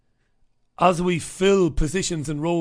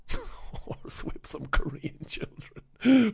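slow, quiet, giggling through it; laughing repeat; 4 semitones higher, 5 dB quieter, much slower than usual. Real, not acted. Repeating his joke to listeners, slow and laughing. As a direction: laugh at your own joke as you say it.

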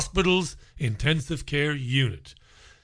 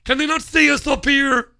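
mock shout; 9 semitones higher, 4 dB louder than usual. Host mocking the nurse's plea to his audience, shouted. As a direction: shout it high and loud, mocking.

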